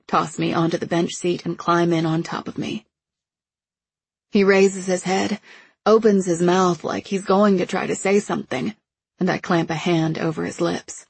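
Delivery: plain reading voice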